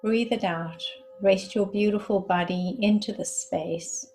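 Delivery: measured meditation guide, long silences between phrases; guided meditation. Acted. Guide asking listeners to breathe and rest into the space, calm meditation voice. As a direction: lead them inward in unhurried phrases, letting each one settle.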